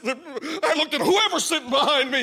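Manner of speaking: ordinary talk, nothing marked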